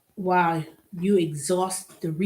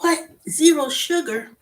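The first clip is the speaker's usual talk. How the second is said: higher, louder, slower incredulous question; amazed disbelief; 8 semitones higher, 4 dB louder than usual. Retold or imitated. Reading the tea label, amazed disbelief. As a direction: stretch it out in disbelief. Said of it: "I was like".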